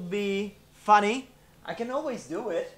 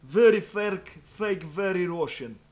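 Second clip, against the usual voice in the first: hammed-up stupid Russian accent; thick mock Russian accent, played dumb; fake Russian accent. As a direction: do a silly, over-the-top Russian meme voice. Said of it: "speaking with a stupid Russian accent".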